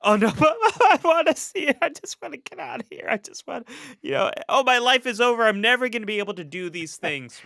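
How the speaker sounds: exaggerated self-pitying whine; mock despairing wail. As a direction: wail like a sulking kid whose life is over. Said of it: "I'm like".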